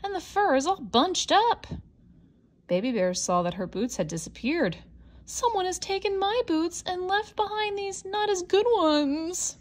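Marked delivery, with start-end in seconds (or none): 0.0-1.7 huffy Mama Bear. Acted. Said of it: "she huffed". 5.2-9.6 high, whiny cub voice; upset little bear near tears; wailing Baby Bear. Acted. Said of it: "she wailed".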